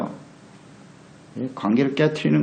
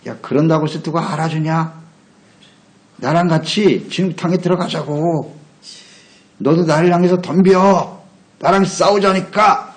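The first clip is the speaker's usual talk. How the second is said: raised, drawn-out taunt; goading devil; 5 semitones higher than usual. Acted. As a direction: tempter daring someone to fight. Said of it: "이게 마귀가 하는 짓이거든요".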